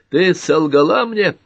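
hurt shout at someone who lied; shouted accusation. A narrator's reading. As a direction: call it out high, loud and slow. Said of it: "крикнул Картер".

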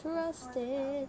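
high, lilting little nudge; playful high voice. Real, not acted. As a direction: lift it high, playfully coaxing. Playful high repeat of phrase.

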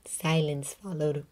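usual speaking voice

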